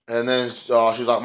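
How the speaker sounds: ordinary talk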